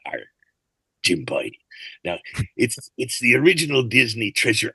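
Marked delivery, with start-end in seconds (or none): 0.1-1.5 low pirate growl of "Arr"; pirate speak. Shown as a demonstration. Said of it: "taught us all how to speak pirate".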